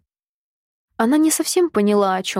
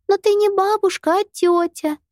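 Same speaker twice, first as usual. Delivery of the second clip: put-on high, insistent child's voice; a small girl insisting; little girl voice; 11 semitones higher than usual. A narrator's reading.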